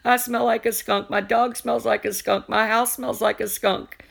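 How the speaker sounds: retelling her skunk panic in a high mock wail; mock bawling. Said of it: "I started bawling. I was like".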